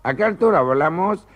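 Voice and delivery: ordinary talk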